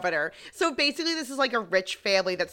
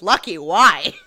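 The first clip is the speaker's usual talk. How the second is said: sudden loud startled question; 11 dB louder, slower than usual. Retold or imitated. Regina startled by Benson, loud startled question. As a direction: jump at the word like startled. Startled rich lady quoted.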